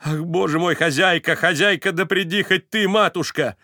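high, loud call for the landlady; calling out in panic. A narrator's reading. Varvara calls the landlady for help in panic. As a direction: shout for the landlady in alarm.

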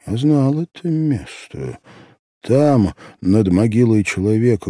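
plain reading voice

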